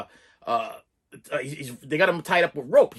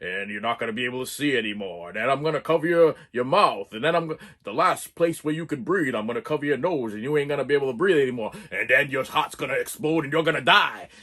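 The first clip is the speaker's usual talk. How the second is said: low, menacing step-by-step threat; menacing killer voice; 6 semitones lower than usual. Retold or imitated. Reviewer voices the masked killer explaining the kill, low and menacing. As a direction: talk low and cold like the killer. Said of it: "He's like".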